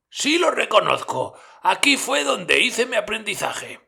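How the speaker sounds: mucho más agudo y fuerte, ilusionado; Scrooge emocionado. A narrator's reading. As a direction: reconoce su pasado con entusiasmo. Scrooge reconoce el almacén. (Scrooge ante el almacén, agudo y emocionado)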